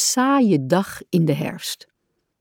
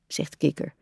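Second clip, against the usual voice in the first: usual speaking voice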